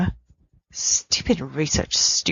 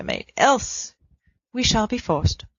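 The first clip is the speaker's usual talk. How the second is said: ordinary delivery, nothing marked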